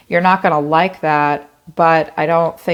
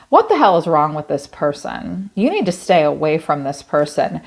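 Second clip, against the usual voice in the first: usual speaking voice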